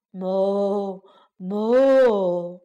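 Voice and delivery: long low moo; cow mooing. A narrator's reading. Cow greets the gingerbread man, low mooing. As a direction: imitate a cow's drawn-out moo. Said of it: "奶牛哞哞叫着说".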